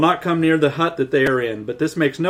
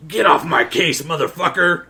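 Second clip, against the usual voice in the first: lightly acted angry movie line; mock-tough impersonation; impersonating a film character; 3 semitones higher than usual. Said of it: "That was a pretty good impersonation".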